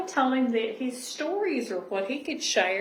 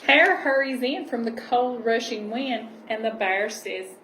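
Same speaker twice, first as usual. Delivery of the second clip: gentle storybook reading voice; cosy read-aloud storytelling; reading to children; 7 dB louder than usual. Acted. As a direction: read it like a bedtime picture book. Reading picture book aloud.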